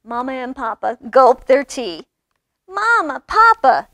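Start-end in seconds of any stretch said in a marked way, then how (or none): 2.7-3.9 high, loud call to parents; cubs yelling. A narrator's reading. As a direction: holler for Mama and Papa. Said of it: "yelled the cubs".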